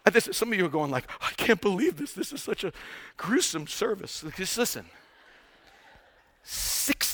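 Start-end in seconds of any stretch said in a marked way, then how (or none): 1.2-4.2 hushed, appalled grumble; mock dismay. Churchgoer appalled by the gruesome sermon, mock dismay. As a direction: complain softly as an offended listener. Voicing appalled members of the congregation.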